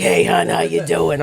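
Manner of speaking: lightly voiced smoker greeting someone; a hint of a raspy smoker; mock raspy smoker voice. Host mocks how she thinks she sounds, light smoker voice. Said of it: "how I feel like I sound".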